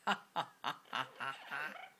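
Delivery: measured, evenly spaced ha-ha laugh; posh laugh. Shown as a demonstration. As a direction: put on a posh laugh, each "ha" separate. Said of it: "posh laugh".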